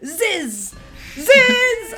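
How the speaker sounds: hissing spit sound. Acted. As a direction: spit the noise out. Acid spit sound effect.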